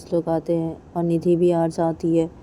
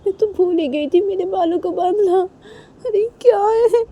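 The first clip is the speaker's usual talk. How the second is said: much higher, shrill-edged put-on voice with cackles; villain mother-in-law; 9 semitones higher, 5 dB louder than usual. Reshma talks to herself about Kajal's glare, high mock-innocent.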